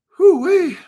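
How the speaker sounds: high, loud, delighted whoop; excited whoop. Real, not acted. Cheering a coin's price jump, high loud whoop. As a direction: whoop it out with glee.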